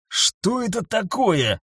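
aghast, drawn-out question; exhaled in shock. A narrator's reading. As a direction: let it out slowly in dazed horror. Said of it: "выдохнул детектив".

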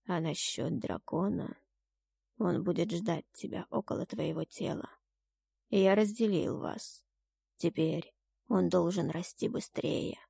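grave, unmoved divine voice; solemn god. A narrator's reading. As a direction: hand down fate calmly as a deity. The god speaks again.

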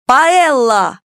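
loud battle cry; shouted spell. A narrator's reading. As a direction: yell the spell out. Girls shout a spell together.

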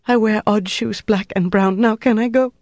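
high, pleading woman's voice; desperate confession. A narrator's reading. Prisoner desperate to be released, desperate confession. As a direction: rush to comply, pitched high and distressed.

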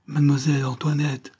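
pleading call by name; exclaiming. A narrator's reading. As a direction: call out to stop the listener. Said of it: "s'écria-t-il".

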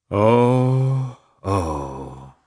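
heavy double sigh, falling away; deep sigh. Acted. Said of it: "he sighed deeply".

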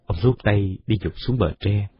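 ordinary delivery

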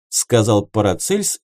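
plain reading voice

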